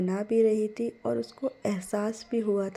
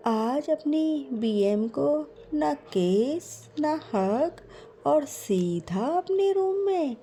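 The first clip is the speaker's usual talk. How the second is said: higher, sweet and doting mum; sweet mother's voice; 6 semitones higher than usual. Acted. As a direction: coo lovingly to your daughter. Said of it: "बहुत मीठी सी आवाज़". (बहुत मीठी, ऊँची प्यार भरी माँ की आवाज़)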